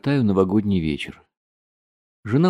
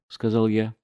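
plain reading voice

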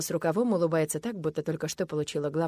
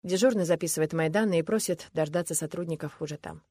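plain reading voice

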